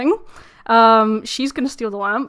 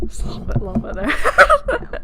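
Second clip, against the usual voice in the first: laughing whisper; 13 semitones higher than usual. Real, not acted.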